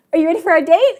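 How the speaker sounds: high, loud hopeful question; eager boyfriend. Retold or imitated. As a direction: imitate an eager suitor asking brightly.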